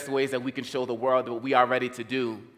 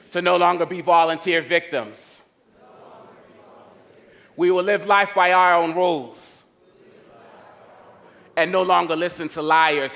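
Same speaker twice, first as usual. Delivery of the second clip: loud, raised call-and-response declaration; rallying pledge; 5 semitones higher, 5 dB louder than usual. Acted. Speaker has the audience repeat after him, rallying pledge. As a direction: proclaim each phrase and leave room for the crowd.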